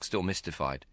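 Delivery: usual speaking voice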